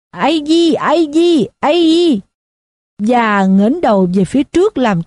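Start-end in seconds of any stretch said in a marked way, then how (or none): 0.1-2.2 put-on high drunken bellow, repeated; drunk yelling. A narrator's reading. Drunk on a chair yelling at the crowd, high bellow. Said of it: "bắt đầu gào lên".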